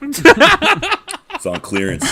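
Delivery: high, slow laughing pitch. Pretend. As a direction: laugh through a fake sales pitch. Joking salesman pitch, laughing.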